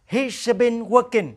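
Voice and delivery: slow, clear teacher model sentence; slow model answer. Shown as a demonstration. Teacher models the answer slowly for the class. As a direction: spell it out slowly for learners. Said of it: "Trả lời".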